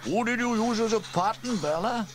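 high-pitched jokey question; Elvis, teasing. A narrator's reading. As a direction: ask it cheekily, voice high. Elvis teases Bella.

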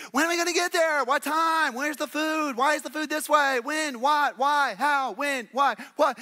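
put-on high, nagging child voice, rapid-fire; whiny kid questions. Imitating a daughter nagging her dad on a long trip, high and whiny. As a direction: pester like a bored kid in the back seat. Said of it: "kinda like my daughter Ruth".

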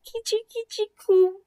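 put-on high cooing baby talk. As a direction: coo at the newborn calf. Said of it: "said Duck".

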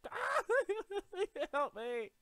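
high loud shriek breaking into whimpers; panicked scream. Real, not acted. Gamer under attack, high panicked shrieking. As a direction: squeal for help in comic terror.

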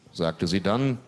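normal talking voice

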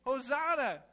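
a high hosanna ring; alarm clock call. Pretend. Alarm clock reminding you each day, high call. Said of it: "it reminds you every day".

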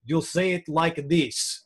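slow, lightly imitated Eastern European accent. Parent coaching daughter's pronunciation, mild Eastern European accent. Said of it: "really thick Eastern European accents".

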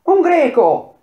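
high, loud shout of warning; piercingly high alarm call; alarmed cry. A narrator's reading. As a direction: cry out the sighting to everyone. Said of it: "una toiana gridò".

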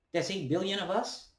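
normal talking voice

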